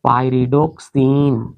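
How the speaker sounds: Pyridoxine drawn out syllable by syllable; teacher slowly sounding out pyridoxine. Shown as a demonstration. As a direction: stretch pyridoxine slowly, lengthening the final सिन. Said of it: "ध्यान से इसका नाम अगर हम लोग निकालेंगे".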